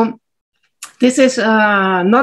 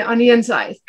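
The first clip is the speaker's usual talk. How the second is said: ordinary talk, nothing marked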